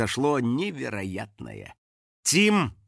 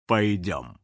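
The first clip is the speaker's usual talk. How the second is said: stern father; 7 semitones lower than usual. A narrator's reading. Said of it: "позвал его отец".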